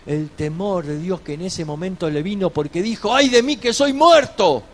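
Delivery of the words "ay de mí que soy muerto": higher, louder lament; anguished cry. Retold or imitated. Isaiah crying out before God, anguished. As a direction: cry out in dismay. Isaiah realizes his impurity.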